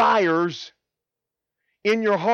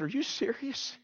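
soft, higher-pitched question; quietly amazed; hushed disbelief; 5 semitones higher, 10 dB quieter than usual. Retold or imitated. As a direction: ask it as if you can't believe it. His disbelief at being invited back.

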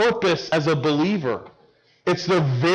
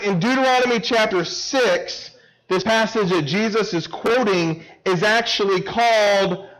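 higher-pitched, weighty proclamation with drawn-out key words; emphatic preaching; 7 semitones higher, 3 dB louder, slower than usual. Real, not acted. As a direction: preach each command as a separate summons.

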